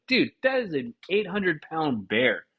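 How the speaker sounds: ordinary delivery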